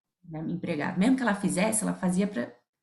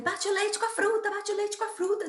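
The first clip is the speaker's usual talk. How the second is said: high, urgent, hurried plea to her husband; calling for help while the baby screams; retold desperate call; 13 semitones higher than usual. Mother urging husband over crying baby, high and desperate. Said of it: "desesperado com a bebê".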